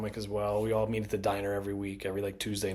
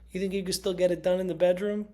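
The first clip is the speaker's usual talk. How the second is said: cheeky Lisa impression; 9 semitones higher, 4 dB louder than usual. Retelling Lisa's cheeky question.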